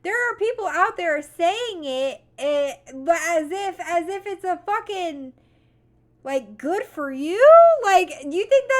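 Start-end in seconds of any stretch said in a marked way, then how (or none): none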